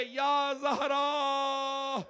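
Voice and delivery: long, drawn out, loud high wail; wailing call. Acted. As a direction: stretch each call into a grieving cry. Ali calling for Zahra, weeping. (Ali Zahra को पुकारते हुए, लंबी ऊँची रोती पुकार)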